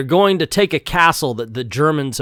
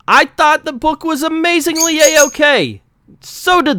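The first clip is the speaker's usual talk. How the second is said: squeaky joke voice; 14 semitones higher than usual. Real, not acted. Reviewer's squeaky punchline voice.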